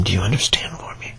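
breathy close-mic whisper; low intimate whisper throughout. Acted. Whispered roleplay.

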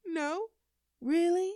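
high, loud mock disbelief. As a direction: act scandalised by the no.

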